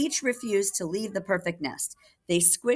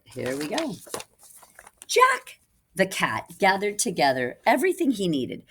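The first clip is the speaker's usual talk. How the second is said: ordinary delivery, nothing marked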